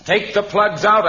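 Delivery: höher, lauter und langsamer wiederholter Befehl; energische Wiederholung. Acted. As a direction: ruf es noch einmal deutlich lauter. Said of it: "I said".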